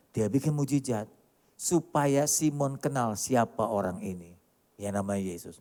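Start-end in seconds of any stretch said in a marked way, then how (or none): none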